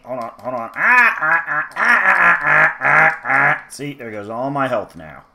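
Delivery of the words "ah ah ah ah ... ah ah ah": pained yelps. Real, not acted. Taking hits from enemies.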